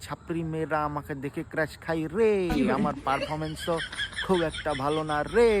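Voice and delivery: normal talking voice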